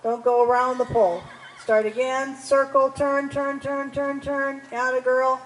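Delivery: loud, carrying riding instructions; calling across arena. Real, not acted. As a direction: project commands across the arena.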